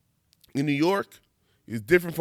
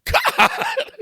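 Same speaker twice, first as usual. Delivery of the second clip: laughed out, much higher and louder; real laugh; 7 dB louder than usual. Real, not acted. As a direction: break up laughing on the word. Laughing at red-flag posts.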